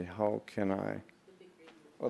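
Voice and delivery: ordinary talk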